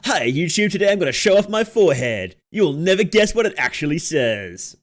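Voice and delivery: clickbait video narrator. Pretend. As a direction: tease the viewer like a clickbait host. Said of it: "a good YouTuber voice?".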